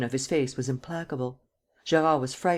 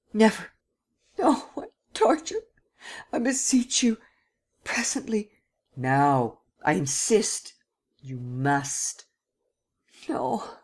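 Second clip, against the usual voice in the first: high, crying desperate plea; Gérard begs Sernine to spare him, crying; anguished refusal; 9 semitones higher, slower than usual. Acted. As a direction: beg in anguish.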